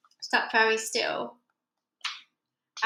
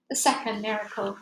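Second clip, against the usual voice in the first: plain reading voice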